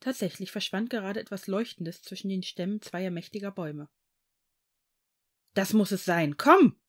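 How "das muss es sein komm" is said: urgent call, bursting louder on the last word; excited call. A narrator's reading. Tom urging his friend onward in the forest, excited call. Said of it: "rief er ihnen zu".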